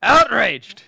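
slow, loud, high outrage; hammed-up outraged listener; mock outrage. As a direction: proclaim it like a grand complaint. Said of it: "Dave from the Wood".

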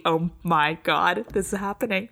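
ordinary talk

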